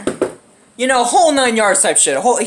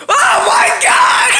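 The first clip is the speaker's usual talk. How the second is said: very high, drawn-out shriek; imitated horrified scream; 4 dB louder, much slower than usual. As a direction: scream it like a shocked victim.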